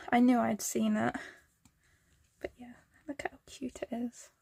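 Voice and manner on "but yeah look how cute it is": soft unvoiced whisper. Real, not acted. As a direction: breathe it out, almost silent. Showing off a cute drawing.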